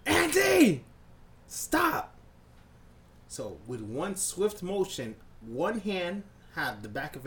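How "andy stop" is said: loud, high shout at a little brother; exasperated high-pitched holler; angry yell. Retold or imitated. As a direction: yell sharply to stop him. Yelling at brother in story.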